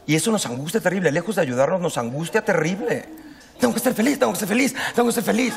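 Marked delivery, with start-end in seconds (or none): 3.6-5.5 higher, mocking sing-song of pressure; anxious self-pressure. Mocking the pressure we all feel to be happy. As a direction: parrot the nagging inner voice.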